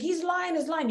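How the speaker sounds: put-on high, childish accusing voice; imitating a sibling crying foul; childish tattling. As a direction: mimic a kid telling on a sibling.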